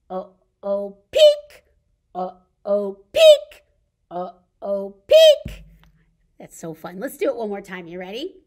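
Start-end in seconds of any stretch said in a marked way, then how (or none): 1.1-1.6 peekaboo squeal. Acted. Peekaboo game with kids. 3.1-3.6 peekaboo squeal. Acted. Peekaboo game with kids. 5.1-5.6 peekaboo squeal. Acted. Peekaboo game with kids.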